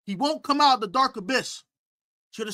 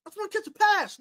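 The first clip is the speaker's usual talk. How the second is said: mock defender; 10 semitones higher, 9 dB louder than usual. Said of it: "And Marshall said".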